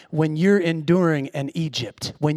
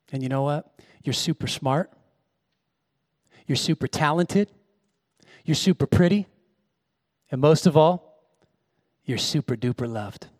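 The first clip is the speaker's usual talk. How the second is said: tender to daughter; 5 semitones lower, 4 dB quieter than usual. Retold or imitated. Father tenderly reassures his daughter.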